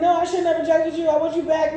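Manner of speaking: high, loud and fast pleading; mock desperate plea. Ex begs the partner to come back, mock desperate high loud plea. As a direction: beg them back in a hammed-up rush. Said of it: "Somebody's like".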